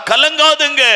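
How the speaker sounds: high, loud கலங்காதுங்க falling; urgent cry. Shown as a demonstration. Preacher urging the frightened congregation not to fear, high and loud. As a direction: shout it out to the fearful. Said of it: "repeated softly".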